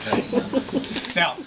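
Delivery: normal talking voice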